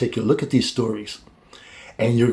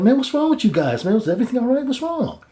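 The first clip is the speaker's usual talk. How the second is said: much higher, caring voice asking what's wrong; higher concerned young man; concerned Joseph; 11 semitones higher, faster than usual. Retold or imitated. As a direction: voice Joseph checking on his friends.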